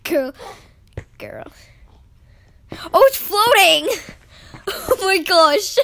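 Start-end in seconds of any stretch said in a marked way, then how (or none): 2.9-5.8 high, loud, laughing outburst; amazed exclaiming. Real, not acted. Gamer seeing something floating, amazed exclaiming. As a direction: cry out in delighted amazement.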